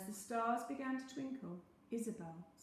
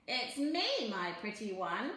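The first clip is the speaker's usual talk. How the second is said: cackling; 6 dB louder than usual. A narrator's reading. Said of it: "he cackled".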